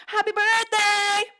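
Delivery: very high, loud, sustained whoop; celebratory shout. Real, not acted. Streamer wishes Mugen happy birthday, high loud cheer. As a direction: belt it out high and stretched.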